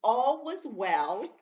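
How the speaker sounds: teacher stresses the key word for the class; emphatic reading. Acted. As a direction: linger on it for the class.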